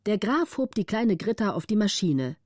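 ordinary delivery, nothing marked

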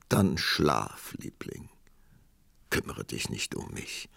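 leise, fast tonlos getröstet; sanft beruhigend. A narrator's reading. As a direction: sanft und selbstlos in den Schlaf schicken. Armer Prinz beruhigt die müde Prinzessin. (zärtlich und leise)

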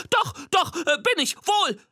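squeaky, flustered claim; squeaky monster insisting. Acted. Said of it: "behauptet das Monster".

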